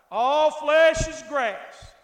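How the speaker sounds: loud, slow, drawn-out preaching; forceful scripture proclamation. Real, not acted. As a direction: thunder the verse out slowly.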